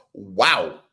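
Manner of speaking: usual speaking voice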